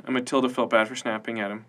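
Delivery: ordinary delivery, nothing marked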